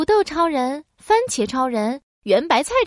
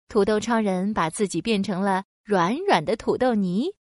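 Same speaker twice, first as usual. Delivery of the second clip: ordinary delivery, nothing marked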